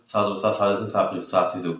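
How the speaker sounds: rattled-off সা জবর সা drill; hurried. Shown as a demonstration. Teacher showing what not to do, hurried gabbled drill. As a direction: rush সা সি সু with the syllables running together. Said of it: "তাড়াহুড়ো করলে কিন্তু হবে না".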